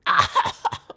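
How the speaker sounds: high-pitched cackling laugh; acted peal of cackling; cackle. A narrator's reading. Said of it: "Eric and Johnson to cackle".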